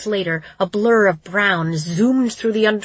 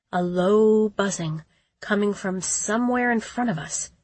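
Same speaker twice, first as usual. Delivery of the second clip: ordinary delivery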